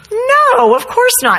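put-on high, loud little girl; child's reply. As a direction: play the daughter, high and sure. Daughter answers mom.

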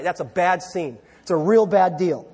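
normal talking voice

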